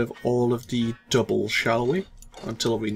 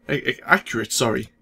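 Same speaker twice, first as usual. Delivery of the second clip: usual speaking voice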